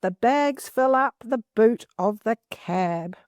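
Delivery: slow, careful read-aloud of the sentence; steady storybook reading. Shown as a demonstration. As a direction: read it out slowly for the class. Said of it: "This particular sentence says".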